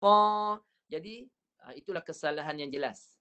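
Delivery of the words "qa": Qa pushed through the nose; nasal Qa as the error. Shown as a demonstration. Teacher repeats the nasal Qa error. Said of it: "Sepatutnya qa".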